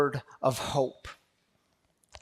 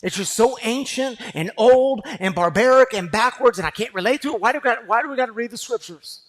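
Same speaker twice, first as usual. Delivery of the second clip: mock skeptic; 6 semitones higher, 7 dB louder, faster than usual. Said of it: "Some people will say".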